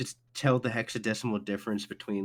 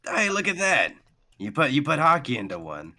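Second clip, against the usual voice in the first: impressed sword; 6 semitones higher, 5 dB louder than usual. Acted. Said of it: "he goes".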